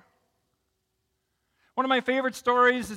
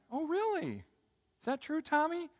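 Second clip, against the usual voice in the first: high, softer questioning voice; surprised questioning grandma; 8 semitones higher, 4 dB quieter than usual. Acted. Grandma asking Tommy about the dishes, high and soft. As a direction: lift it high, lightly, as a mock-innocent question.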